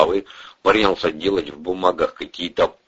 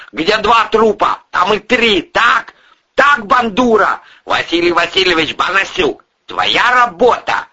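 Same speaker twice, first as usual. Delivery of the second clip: roaring accusation; 13 semitones higher, 5 dB louder than usual. Acted. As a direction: pound out each charge at the suspect. Boss roars murder accusations.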